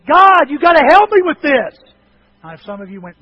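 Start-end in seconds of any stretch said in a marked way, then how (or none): 0.0-1.8 loud, high-pitched desperate plea; full-voiced cry for God's help. Shown as a demonstration. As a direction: shout it to heaven as an urgent prayer. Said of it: "Or is it".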